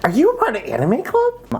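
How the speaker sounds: high, put-on anime nerd voice; nerdy voice. Shown as a demonstration. Showing off a silly voice to a co-host, nerdy voice. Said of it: "the dirty voice".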